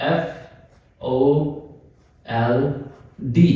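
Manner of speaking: "एफ ओ" drawn out very slowly and softly; very slow spelling. Shown as a demonstration. Teacher spelling fold out letter by letter. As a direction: spell "एफ ओ एल डी" with long pauses. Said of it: "मैं कहता हूं".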